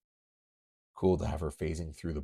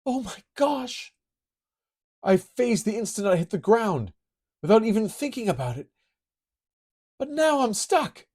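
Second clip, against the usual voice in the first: put-on bright higher girl voice, quick and eager; high girlish voice; 14 semitones higher, 7 dB louder than usual. Kitty falls underground, high girlish voice. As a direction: play the young heroine thinking aloud.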